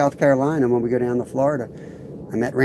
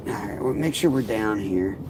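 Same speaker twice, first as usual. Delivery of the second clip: ordinary talk, nothing marked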